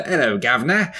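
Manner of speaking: imitated Cockney greeting with rising end; mock Cockney. Host teasing co-host over the clichéd greeting, mock Cockney. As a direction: quote it back in hammed-up Cockney. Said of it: "Cockney, Cockney, Cockney".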